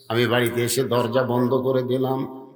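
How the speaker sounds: ordinary delivery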